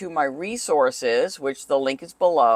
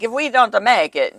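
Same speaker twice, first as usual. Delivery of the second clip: light imitation of a heavy Italian accent; 5 semitones higher, 4 dB louder than usual. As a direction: mimic a late husband's Italian accent. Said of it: "so it sounded more like".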